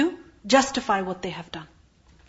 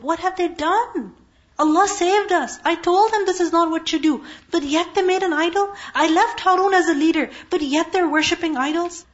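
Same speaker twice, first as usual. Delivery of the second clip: upset rebuke; 6 semitones higher than usual. Retold or imitated. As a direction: let the hurt and anger rise together. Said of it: "He was very upset".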